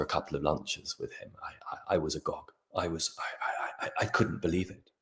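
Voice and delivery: hushed whisper, words barely voiced; breathless awed whisper, slowing down. Real, not acted. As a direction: whisper it as if still amazed. Recalling being stunned by mentor.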